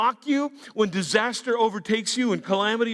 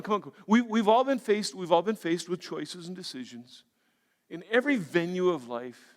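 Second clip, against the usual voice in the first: ordinary delivery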